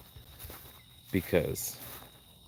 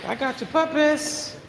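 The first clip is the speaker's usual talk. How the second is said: cooing to puppies; slower than usual. Pretend. Sing-song to the puppies.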